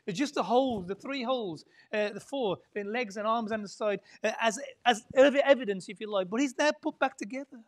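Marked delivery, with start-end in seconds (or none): none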